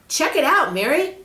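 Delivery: put-on excited boy. Peter shows Mary the glowing word, thrilled. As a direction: burst out with glee.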